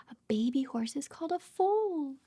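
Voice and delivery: plain reading voice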